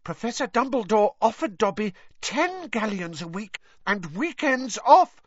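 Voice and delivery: high elf voice, drawing out the offer; awed Dobby elf. Acted. Dobby reveals what Dumbledore offered, high and awed. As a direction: squeak it out as an overwhelmed house elf.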